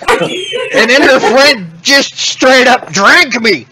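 hammed-up chatty creature, laughing; excited creature voice. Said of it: "It's gonna be like".

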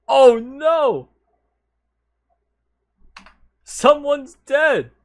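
alarmed shout. Real, not acted. Body found in game.

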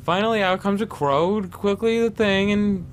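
high-pitched verse reading, giving up; poem reading. Acted. Reading the last bird of the riddle, high recital.

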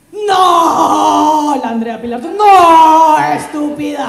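loud crying shout; tearful scream, loudest on the second no; dramatic scream. Acted. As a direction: wail at her friend in drunken despair.

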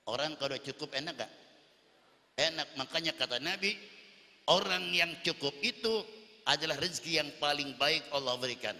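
ordinary talk, nothing marked

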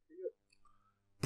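"Pretty good" whispered, slow. Acted. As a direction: breathe "Pretty good" out slowly. Repeating pretty good, then softer.

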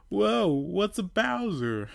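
high, slow amazed whoa; redo as whoa. Acted. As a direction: stretch the wonder out. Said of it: "No, no".

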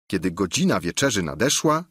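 ordinary delivery, nothing marked

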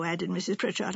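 usual speaking voice

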